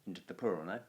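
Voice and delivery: usual speaking voice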